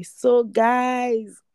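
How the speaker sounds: usual speaking voice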